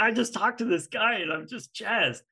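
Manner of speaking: high, buzzing excited retelling; a giddy high burst after the late call; retold excitement. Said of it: "My wife's like".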